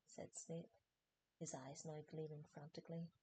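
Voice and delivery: ordinary delivery, nothing marked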